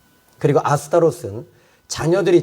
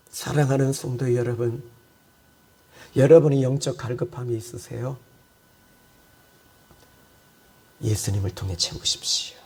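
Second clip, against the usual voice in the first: much lower and softer, intimate; gentle exhortation; 4 semitones lower, 4 dB quieter than usual. Acted. Pastor gently addressing beloved saints, soft and low. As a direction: bring it down to a quiet, close appeal.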